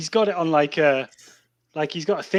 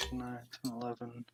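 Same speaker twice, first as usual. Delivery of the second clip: counting under breath; 19 dB quieter than usual. Real, not acted. Player totting up a dice roll, under his breath. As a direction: add it up quietly to yourself.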